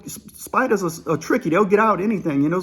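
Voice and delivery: ordinary delivery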